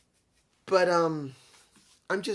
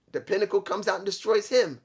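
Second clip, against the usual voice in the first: ordinary talk, nothing marked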